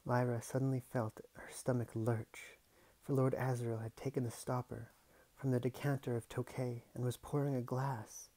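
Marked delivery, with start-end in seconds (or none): none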